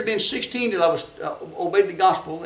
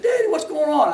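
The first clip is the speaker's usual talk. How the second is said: lightly voiced puzzled child, pitched up; nine-year-old boy; 7 semitones higher than usual. Nine-year-old asks his daddy for help, higher boyish voice. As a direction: pitch it up like a boy asking Dad. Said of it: "I must have been nine years old".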